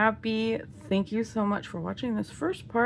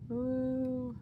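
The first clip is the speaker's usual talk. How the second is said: ordinary talk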